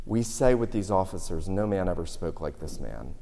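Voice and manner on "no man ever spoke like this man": reverent hush. Shown as a demonstration. Officers' words said in reverence.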